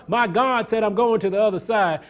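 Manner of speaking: rising to a high, loud preaching cry as Jesus; full-force preaching. Acted. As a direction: hammer it home like a revival preacher.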